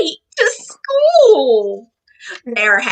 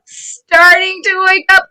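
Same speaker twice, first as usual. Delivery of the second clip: ordinary delivery, nothing marked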